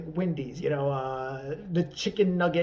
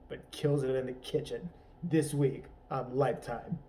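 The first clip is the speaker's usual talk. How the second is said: trailer voiceover; 3 semitones lower than usual. Acted. Movie trailer voiceover parody.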